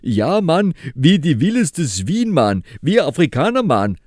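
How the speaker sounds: put-on high, loud heavy-accented yell; Katanka shouting. A narrator's reading. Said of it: "råbte mister Katanka".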